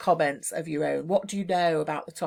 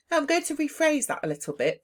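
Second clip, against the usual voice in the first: pitched up, bright and sure of yourself; confident rephrase; 8 semitones higher than usual. Shown as a demonstration. Teacher modelling a confident rephrase for exam students. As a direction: say it like you mean it. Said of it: "but say it with confidence".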